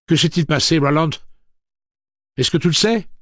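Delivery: high, loud frantic demand; frantic shout. Acted. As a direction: shake the answer out of him. Eddie demands of Roland.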